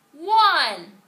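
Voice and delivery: high, loud holler of a class counting. A narrator's reading. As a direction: yell the number out across the playground. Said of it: "hollered room eight".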